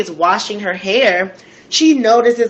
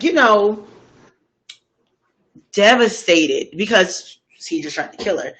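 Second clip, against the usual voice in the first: ordinary delivery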